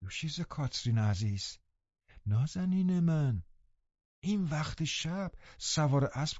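put-on softly questioning a girl caught sneaking in; gentle reproach. A narrator's reading. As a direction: scold her tenderly, not angrily. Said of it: "اصلاً نمیخواستم با او اوقات تلخی کنم".

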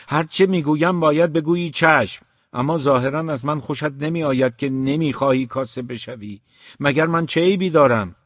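loud, high furious shout; shouting father. A narrator's reading. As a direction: yell at the son in rage. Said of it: "یک بار فریاد کشید". (فریاد خشمگین پدر)

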